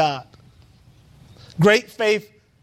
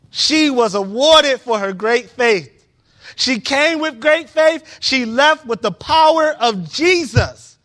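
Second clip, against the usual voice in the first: rising fervent sermon peak; full-force preaching; 7 semitones higher, 5 dB louder than usual. Real, not acted. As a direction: drive it home to the congregation.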